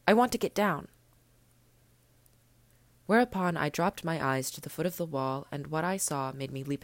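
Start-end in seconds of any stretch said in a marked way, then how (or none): 0.1-0.9 put-on louder, higher boyish order; urgent command. Little prince orders the snake away, louder and higher. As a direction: push them away with a sharp raised voice.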